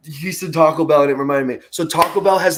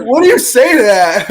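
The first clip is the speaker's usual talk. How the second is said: baffled; 9 semitones higher, 8 dB louder than usual. Retold or imitated. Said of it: "I was like".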